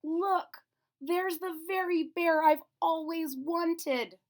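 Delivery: put-on high, slow, excited child voice; a little girl begging her mother; girl pleading, high and bright. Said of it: "she said".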